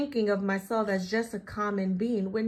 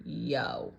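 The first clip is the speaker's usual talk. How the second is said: much lower, laid-back yo; cool guy yo; 12 semitones lower, 5 dB quieter than usual. Pretend. Cool bean greeting the reader, low and laid-back. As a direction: drop it low like a cool kid.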